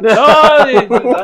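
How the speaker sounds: ordinary delivery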